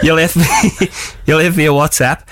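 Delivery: trying to speak through laughter; laughing through words. Real, not acted. Radio host leaving a listener a voicemail, giggling. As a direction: restart the message while cracking up.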